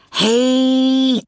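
ordinary delivery

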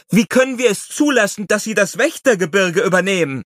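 high, loud, pleading; shrill protest. A narrator's reading. Young wolf protests shrilly.

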